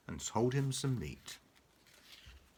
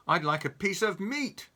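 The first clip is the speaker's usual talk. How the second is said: loud higher shout; 7 semitones higher, 6 dB louder than usual. Acted. Mister Noisy shouts at the ignoring butcher. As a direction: shout it again. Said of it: "Mister Noisy shouted".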